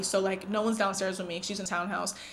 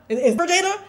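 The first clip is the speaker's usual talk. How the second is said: "Is, is for Jada?" pitched high and louder, rising hard on "Jada"; high insistent question; 8 semitones higher, 5 dB louder than usual. Retold or imitated. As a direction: call out "Jada" up high, a little louder and pressing. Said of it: "Each person, I'm going".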